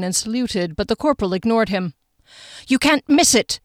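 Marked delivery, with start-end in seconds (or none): 2.7-3.6 mild rage. A narrator's reading. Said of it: "Her eyes narrowed with rage".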